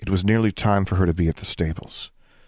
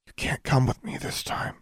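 soft breathy whisper; 7 semitones higher, 5 dB quieter than usual. Acted. Alexandra whispers to the raven it cannot come. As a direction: keep whispering to the bird.

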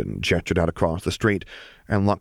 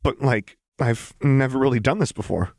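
a touch of nerves, pitched up; higher, mildly shaky confession; slight tremor; 6 semitones higher than usual. Acted. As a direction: voice wobbling a little with shyness. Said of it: "with an uncharacteristic tremor in her voice".

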